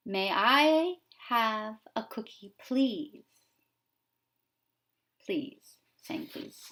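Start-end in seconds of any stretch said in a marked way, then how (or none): 0.1-3.2 slow, sweet little duckling asking nicely; polite duckling. Acted. Teacher voicing the duckling asking for a cookie, sweetly. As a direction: ask for the cookie ever so politely. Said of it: "The duckling saying".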